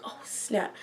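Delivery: breathy whisper of sudden alarm; whispered dismay. Retold or imitated. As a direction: whisper it, realizing the trouble. Private panic about grad night.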